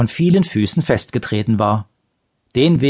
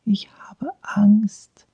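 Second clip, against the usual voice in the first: slow, frightened whisper; scared whisper; 10 semitones higher, much slower than usual. A narrator's reading. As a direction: barely breathe the confession. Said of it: "sagte er so leise".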